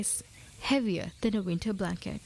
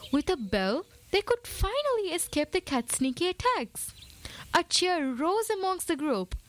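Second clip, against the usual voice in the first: usual speaking voice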